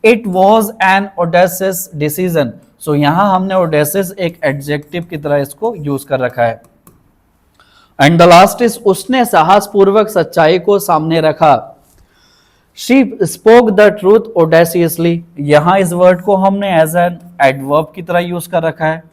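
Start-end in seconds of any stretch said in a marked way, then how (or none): 0.0-2.5 slow, clear example-sentence reading. Shown as a demonstration. Teacher reading an example sentence to students, slow. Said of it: "example sentence". 12.8-15.2 slow, clear example-sentence reading. Shown as a demonstration. Teacher reading an example sentence to students, slow. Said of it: "example sentence".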